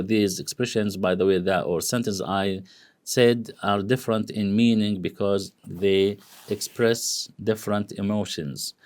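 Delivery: usual speaking voice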